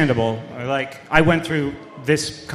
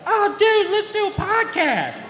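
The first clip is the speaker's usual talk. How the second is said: much higher, dazed Southern chef voice; Muppet chef voice. Retold or imitated. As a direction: imitate the spaced-out guy in the alley. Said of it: "like Chef from the Muppet Show if he had a Southern accent".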